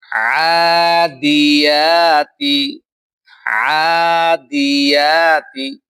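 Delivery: slow chanted "আদিয়াতি" with the ya sounded like a hamza; hamza-like ya, wrongly. Shown as a demonstration. Teacher shows the common mistake, "আদিয়াতি" chanted with hamza-like ya. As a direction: let the ya of "আদিয়াতি" slip into a glottal hamza. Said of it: "এটা ভুল".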